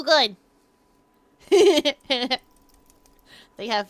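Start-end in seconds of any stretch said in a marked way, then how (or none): 1.5-2.4 loud, high giggle. Real, not acted. Creature pleased at wiggling out, giggling. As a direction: burst into a pleased little laugh.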